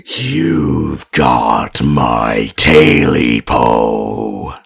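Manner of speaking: eerie creature threatening from his chest; menacing creature voice. Acted. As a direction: growl the threat, then bark the last word. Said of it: "looked straight down at him and said".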